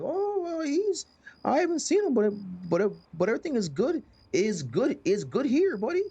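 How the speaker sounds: squeaky sing-song put-on cheer; high fake-friendly voice. Townsperson hiding the missing dad, high fake-cheery voice. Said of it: "And they're like".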